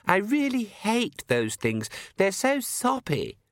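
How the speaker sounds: high, petulant whine; whiny complaint. A narrator's reading. Complainer daughter griping to her parent, whining. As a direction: draw it out in a peevish high voice. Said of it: "the voice of a chronic complainer".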